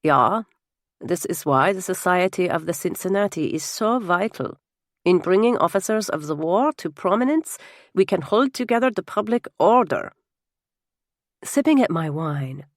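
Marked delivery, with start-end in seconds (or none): 0.0-10.1 put-on Baron speaking English with a heavy German accent; thick Germanic accent. Baron defends his society at table, thick Germanic accent. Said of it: "in his thick Germanic accent".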